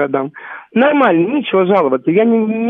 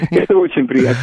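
usual speaking voice

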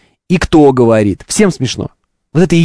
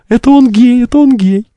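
mocking taunt; 7 semitones higher than usual.

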